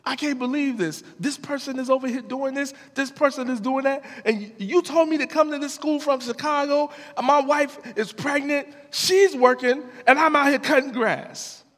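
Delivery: high, fast, heated rant; giving God the business. Retold or imitated. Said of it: "giving God the business".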